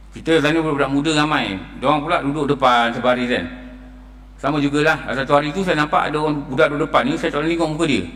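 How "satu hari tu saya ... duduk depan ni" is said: normal talking voice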